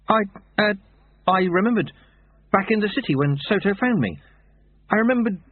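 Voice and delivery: a touch of dazed hesitation; weakly. Acted. Shaken boy tells the old monk what he remembered, mildly weak. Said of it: "said Lobsang weakly".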